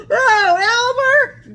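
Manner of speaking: high delighted gasp; old elephant gasping. A narrator's reading. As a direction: gasp it out in laughing wonder. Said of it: "gasped an old elephant".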